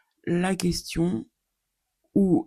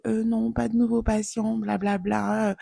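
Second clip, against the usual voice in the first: higher-pitched brush-off of a patient; mock refusing doctor; 7 semitones higher than usual. Doctor turning away new patients, mock high-pitched refusal. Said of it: "Médecin traitant égale".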